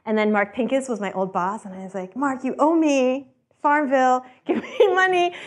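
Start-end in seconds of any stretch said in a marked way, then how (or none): 2.1-5.3 high, slow, cheeky demand for money; mock-demand it, teasing; playful demand. To her old boss Mark, a high teasing demand for money.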